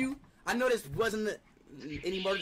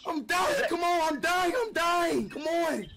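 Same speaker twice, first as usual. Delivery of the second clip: high, hammed-up wail of agony; fake dying screams; 4 semitones higher, 3 dB louder, much slower than usual. Prank caller pretending to die, fake agonized screaming. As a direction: scream as if badly hurt, overdone.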